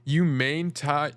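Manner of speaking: ordinary talk, nothing marked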